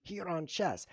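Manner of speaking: ordinary delivery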